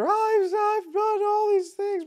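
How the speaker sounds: hammed-up explosion of frustration; angry outburst. Anxious employee finally blowing up at the team, mock shout. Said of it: "Or it's this explosion".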